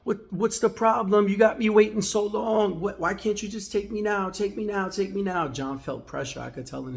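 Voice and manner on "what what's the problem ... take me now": higher, louder mock-irate whining; retold angry customer, pitch way up; mouthing off. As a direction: nag like a fed-up customer in the chair. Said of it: "He started mouthing off".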